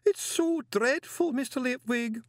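very high, drawn-out lament; despairing wail. A narrator's reading. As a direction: wallow in misery, wailing slowly. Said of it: "determined to drain the bitter cup of despair".

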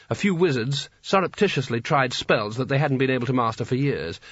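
usual speaking voice

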